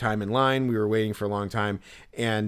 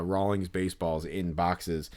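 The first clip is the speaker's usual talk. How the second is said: normal talking voice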